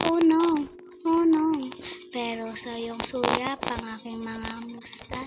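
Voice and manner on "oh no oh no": higher and louder, alarmed. Real, not acted. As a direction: react as something goes wrong. Alarm in game.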